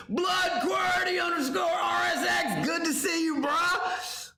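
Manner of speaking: hype shout. Real, not acted.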